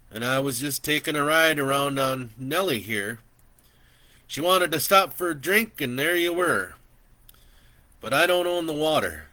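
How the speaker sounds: put-on an old man chatting easily from horseback; gentle old landowner welcoming a stranger; kindly old man. Old rancher voiced in story.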